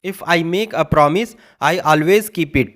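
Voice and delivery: very slow, word by word English; careful classroom reading; slow dictation. Shown as a demonstration. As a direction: spell it out slowly for learners. Teacher dictating English example.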